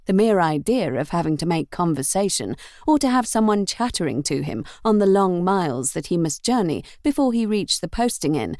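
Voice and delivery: ordinary delivery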